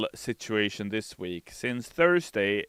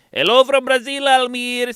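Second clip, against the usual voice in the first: excited; 11 dB louder than usual. Acted. Reading chat message excitedly.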